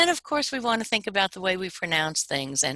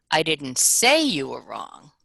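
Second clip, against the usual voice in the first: "say" stretched and raised above the rest; stress on SAY; 4 dB louder, slower than usual. Shown as a demonstration. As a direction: lean hard on a long "say". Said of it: "Now I'll emphasize say".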